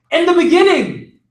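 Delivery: high, loud call of the congregation; cried out. A narrator's reading. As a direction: cry it out like a sermon. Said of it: "they cried".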